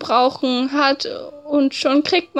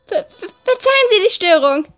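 high, shaky stuttered apology; nervous stammer; 7 semitones higher, 3 dB louder than usual. Acted. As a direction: stammer out an excuse. Flustered apology fleeing doctor.

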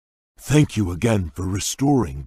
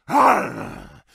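startled cry; 5 dB louder than usual. Acted. Book keeps falling apart.

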